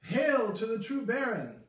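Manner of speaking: loud, high rallying cry; acclaiming shout. Acted. Forest fighters acclaiming their new Baron, loud shout. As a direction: hail him like a kneeling crowd of fighters.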